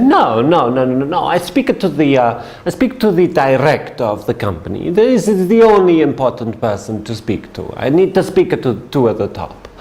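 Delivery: put-on firm, lightly accented no-no-no; Italian businessman. Salesman correcting his seatmate, insistent light Italian accent. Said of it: "he said".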